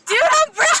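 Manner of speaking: high, loud mock-indignant yell; protesting yell. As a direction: shout it back in comic disbelief. Acts own protest to coworker.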